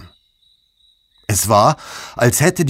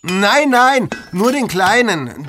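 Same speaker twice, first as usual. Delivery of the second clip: eager character voice; 11 semitones higher than usual. Acted. Murkas voiced in story.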